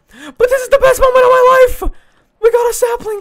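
very high, very loud shrieks of joy; ecstatic screaming. Real, not acted. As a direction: scream it like you just won the lottery. Sapling joy resumes.

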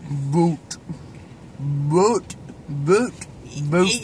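low, drawn Cajun "Boat". Shown as a demonstration. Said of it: "You got it".

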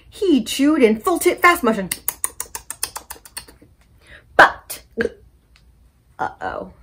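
put-on lively picture-book read-aloud for kids; story time reading. A narrator's reading. Librarian reading a story to children, animated. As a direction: read it bright and animated to little listeners.

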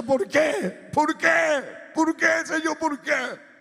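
high, tearful shouted plea, reenacted; crying out. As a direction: wail the question up at God. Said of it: "llorando le decía".

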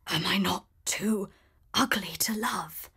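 soft whispered question; the beast asks Belle in a soft whisper; sad whisper. A narrator's reading. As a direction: ask it faintly, afraid of the answer. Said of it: "he asked".

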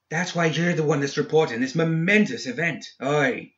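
high, emphatic character exclamation; exclaiming. A narrator's reading. Seamus reminds his silent reporter of her job, exclaiming. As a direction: exclaim it in character, pitched well up. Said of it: "he exclaimed".

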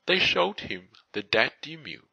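ordinary delivery

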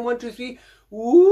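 high, loud rising whoosh; big loud whoosh. Shown as a demonstration. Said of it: "can you come back again".

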